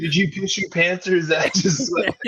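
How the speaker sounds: high put-on teasing voice; mock heckling. Heckler teasing a sweaty golfer in pants, high mocking voice. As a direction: needle him in a high mock voice.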